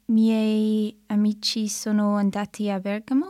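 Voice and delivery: usual speaking voice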